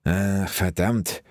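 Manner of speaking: slow, drawn-out grumbled curse; grumbling curse. A narrator's reading. Wilson grumbling at the stench of rot. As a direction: mutter the swear in disgust. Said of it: "brummte er erneut".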